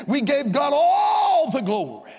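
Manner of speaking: slow, high preacher's cry; soaring testimony climax, drawn out; exultant praise. Real, not acted. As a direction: stretch it out into a ringing shout of praise.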